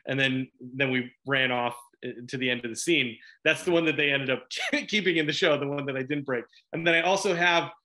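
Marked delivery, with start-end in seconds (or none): none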